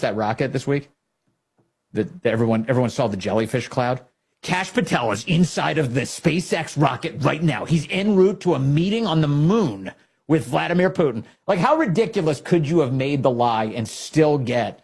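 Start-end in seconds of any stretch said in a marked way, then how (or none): 4.4-11.2 high, emphatic parody news bulletin; mock breaking news. Host inventing a hysterical lie for broadcast, mock breaking news. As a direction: announce the absurd story like urgent breaking news.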